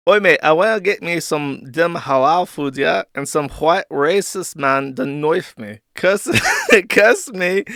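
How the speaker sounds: lightly voiced London slang, cocky; a hint of a London roadman begging the police; mock London roadman. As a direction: streetwise London caller, lightly done. Reading a caller's message in slang.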